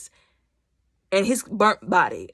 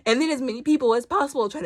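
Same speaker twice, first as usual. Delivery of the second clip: laughing; 6 semitones higher, faster than usual. Real, not acted.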